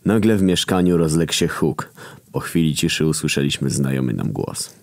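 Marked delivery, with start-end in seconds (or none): none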